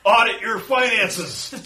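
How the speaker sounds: yelling. Pretend. Yelling at zombies as banker.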